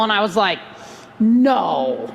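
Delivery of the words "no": low, drawn-out astonishment; mock-incredulous, dropped pitch; feigned disbelief. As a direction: stretch it low, as if stunned. Feigns disbelief at lucky timing.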